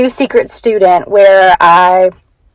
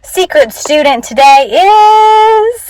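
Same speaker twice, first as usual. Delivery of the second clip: slow, high, drawn-out teaser; suspenseful announcement; 9 semitones higher, slower than usual. Retold or imitated. Teacher to her class before the reveal, slow suspenseful tease. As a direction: stretch it to build suspense. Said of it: "and I say".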